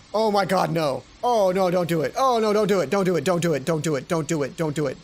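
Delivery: loud begging that fades down; pleading. Real, not acted. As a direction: plead loudly, then let it drain away with each repeat. Begging Hana not to alter.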